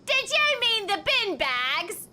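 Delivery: high, slow, hammed-up British question; mock British lady. Shop lady correcting Archie's garbage bags, mock British accent. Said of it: "this lady went".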